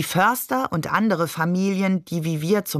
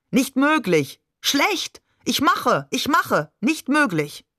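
high-pitched, broken exclamations; agitated protest; 6 semitones higher, slower than usual. A narrator's reading. Agitated phone fragments in broken speech.

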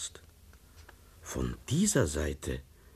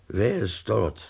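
usual speaking voice

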